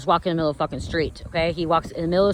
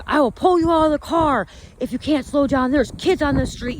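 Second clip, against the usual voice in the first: much higher, threatening retold shout; angry yelling; 8 semitones higher than usual. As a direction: warn the reckless kids like an angry father. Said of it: "Mike's yelling at him".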